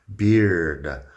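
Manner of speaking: teacher modelling beard; long i in beard. Shown as a demonstration. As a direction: lengthen the i of "Beard". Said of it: "tiene la I un poco más larga".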